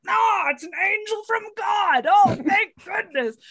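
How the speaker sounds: high, mock-relieved cry to heaven; hammed-up gasp of deliverance; overjoyed Scottish man. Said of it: "this really stinky Scottish man who's like".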